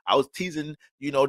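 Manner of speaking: normal talking voice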